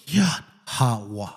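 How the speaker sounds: "Yahweh" drawn out slowly as ya-ha-wa; slow syllable-by-syllable "Yahweh", said ya-ha-wa; demonstrated pronunciation. Shown as a demonstration. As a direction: stretch "Yahweh" into slow, open ya, ha, wa. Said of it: "Like that".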